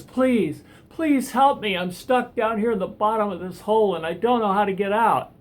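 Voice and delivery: high, loud, frantic begging up from a pit; yelling up out of a hole for rescue; desperate loud plea. Acted. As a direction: shout up for help, desperate. Man in hole begs passerby.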